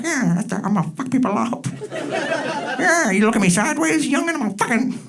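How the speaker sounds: high, laughing mock tough talk; old tough guy. Retirement home crowd hypes up after the action film. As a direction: threaten like a hammed-up retiree. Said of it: "they're like".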